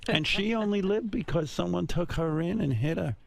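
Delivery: put-on high voice, milking the family tragedy; dramatic relative retelling tragedy. Imitating a relative recounting Grandma Rose's losses, high and dramatic.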